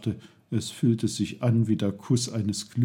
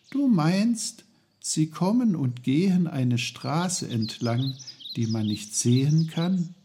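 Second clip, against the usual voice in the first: put-on higher-pitched, questioning boy's voice; Peter, puzzled child; 6 semitones higher than usual. Boy asks his uncle about the invisible road, puzzled.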